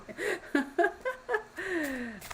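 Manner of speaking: high breathy burst of laughter; laughing. Real, not acted. Laughing to her viewers at her own husky joke, high giggle. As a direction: break into a real high laugh.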